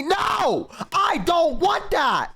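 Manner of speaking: high, drawn-out cry of protest; mock outraged refusal. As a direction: refuse it in comic horror, pitch way up. Mock outburst refusing maid chant.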